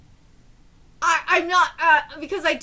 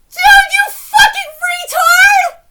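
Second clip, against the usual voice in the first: high, drawn-out angry yell; real outraged screaming; furious shout; 13 semitones higher, 13 dB louder, slower than usual. Real, not acted. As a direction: scream the insult slowly at full volume. Yells at a manga character.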